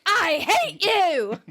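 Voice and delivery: slow, loud, high mock shout. Bride shouting at Ross, loud and slow. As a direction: bellow it out slowly. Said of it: "Emily shouts".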